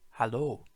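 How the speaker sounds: Hallo said clearly as a model word; model German Hallo. Shown as a demonstration. Teacher models Hallo for beginners, clear. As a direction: say Hallo for the learner to repeat. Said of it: "we say".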